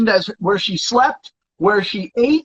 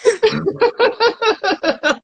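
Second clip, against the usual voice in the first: high mocking laugh; 11 semitones higher than usual. Real, not acted. Laughing at friend's denial.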